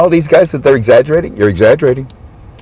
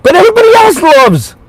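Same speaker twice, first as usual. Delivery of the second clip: excited, high emphatic burst; 13 semitones higher, 3 dB louder than usual. Real, not acted. Vlogger cheering on the leader, high and excited. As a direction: lift up high on everybody else loves.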